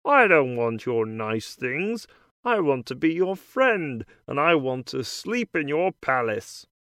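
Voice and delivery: lightly voiced frog character; a hint of a croaky frog. A narrator's reading. Frog continues speaking.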